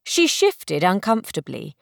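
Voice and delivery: ordinary delivery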